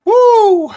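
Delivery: high, loud drawn-out "woo"; loud high whoop. Real, not acted. Reacting to a viewer's kidney stone, loud high whoop. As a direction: let out a piercing whoop.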